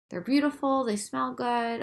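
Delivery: ordinary talk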